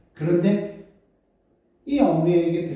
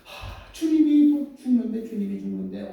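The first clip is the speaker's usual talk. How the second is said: high, wailing grief over the dying son; tearful lament; 5 semitones higher than usual. Acted. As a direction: cry out in helpless sorrow. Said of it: "한없이 눈물을 흘리면서". (높은 목소리로 울먹이며)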